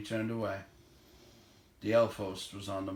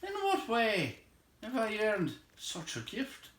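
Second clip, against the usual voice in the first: higher, stately elf king; lightly voiced elf king; 11 semitones higher than usual. A narrator's reading. The Elven King blessing Gandalf, higher and regal. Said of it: "said the king".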